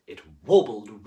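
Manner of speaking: a loud showy warble. A narrator's reading. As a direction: belt the word out. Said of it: "performed sound word".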